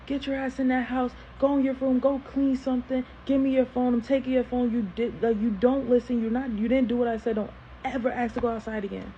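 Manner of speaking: retold parental tirade; imitating scolding dad. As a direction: fire off commands like an angry father. Acts out dad scolding on arrival.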